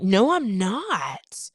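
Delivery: slow, loud, clipped-off denial "No, I'm not"; defensive denial. Retold or imitated. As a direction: insist you're not mad while clearly mad. Defensive partner denying being mad.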